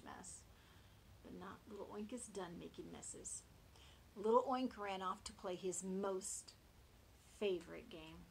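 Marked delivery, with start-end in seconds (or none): none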